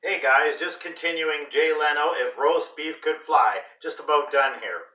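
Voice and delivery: ordinary delivery, nothing marked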